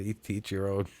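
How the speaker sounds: ordinary talk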